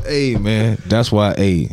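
ordinary talk

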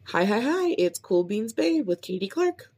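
high bouncy greeting into show name; upbeat podcast intro. Shown as a demonstration. Podcaster rehearsing her show intro, upbeat host voice. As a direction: sell it like a cheerful host. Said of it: "let's try this one".

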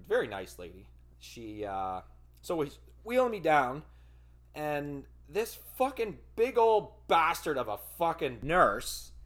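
ordinary delivery

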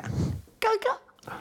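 very high squeaky caca; high playful squeal. Pretend. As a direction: squeal it like a cartoon. Repeats higher, playful squeak.

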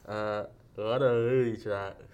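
slow, mangled through a mouthguard; wearing a mouthguard. Real, not acted. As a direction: chew the words around a guard. Mouthguard game reading.

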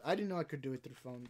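normal talking voice

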